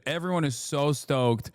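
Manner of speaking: ordinary delivery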